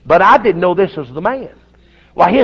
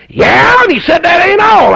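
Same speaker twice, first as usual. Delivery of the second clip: much higher and louder, bursting with news; excited; 12 semitones higher, 7 dB louder than usual. Retold or imitated. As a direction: blurt it out, thrilled.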